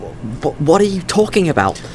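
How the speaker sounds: stammering, high-pitched disbelief; bewildered, stumbling into panic; panicked confusion. Acted. Stammering, confronted with his death.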